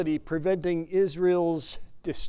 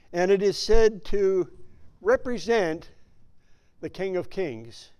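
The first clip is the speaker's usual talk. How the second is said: normal talking voice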